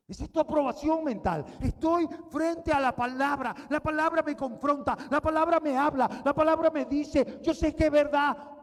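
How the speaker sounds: loud, high, driving preacher's cry; preaching at full force. Acted. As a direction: preach it hard to the congregation. Peak of preaching, shouting. (predicación a todo volumen)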